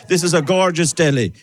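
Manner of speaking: normal talking voice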